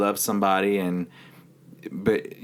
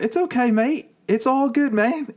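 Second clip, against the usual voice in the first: high mock New Zealand accent, reassuring; lightly done New Zealand accent, calm and reassuring; New Zealand roommate. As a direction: imitate the New Zealand roommate, much higher and easygoing. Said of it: "he's from New Zealand".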